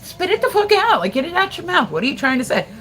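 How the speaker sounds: impatient yelling. Retold or imitated. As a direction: yell at him to get the words out, climbing in frustration. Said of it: "and you're like".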